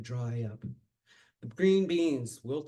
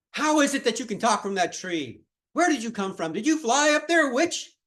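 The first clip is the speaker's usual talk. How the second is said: loud, much higher accusing shouts; angry crowd; 8 semitones higher, 8 dB louder than usual. Acted. Angry villagers shout up at the woman in the tree, high accusing yells. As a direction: yell up into the tree, rising in pitch. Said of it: "they still were so angry".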